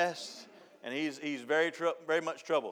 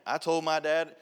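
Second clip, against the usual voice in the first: normal talking voice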